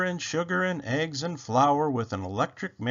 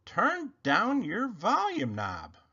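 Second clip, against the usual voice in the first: slow, high fussing voice; lightly voiced Rabbit; 8 semitones higher, slower than usual. A narrator's reading. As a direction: tell him off gently. Rabbit scolding.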